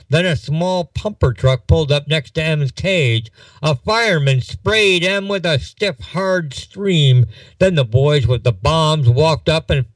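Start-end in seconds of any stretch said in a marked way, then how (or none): none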